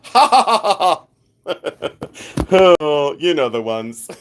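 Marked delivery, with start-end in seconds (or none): 0.0-2.7 big hammed-up fake "ha ha ha", higher; fake laugh. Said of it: "And then let me fake laugh".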